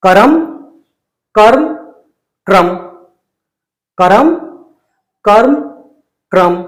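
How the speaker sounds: करम ka-ram, कर्म karm, क्रम kram, each clearly; three-way drill. Shown as a demonstration. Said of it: "मैं तीनों को एक साथ बोलूंगा".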